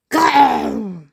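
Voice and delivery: loud, high growl; monster growl. Acted. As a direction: let out a loud cartoon beast growl. Titi the Terrible growls menacingly. (grognement de monstre, fort)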